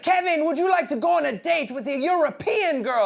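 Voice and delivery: high, loud mock Belgian accent; Christoph. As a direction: pitch it up, eager bad Belgian accent. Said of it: "that's not a Belgian accent".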